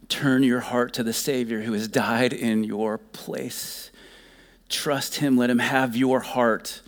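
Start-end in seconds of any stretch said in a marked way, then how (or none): none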